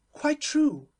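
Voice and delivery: exclaiming. A narrator's reading. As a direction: burst out in delighted agreement. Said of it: "exclaimed the Duchess".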